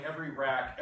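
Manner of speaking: ordinary talk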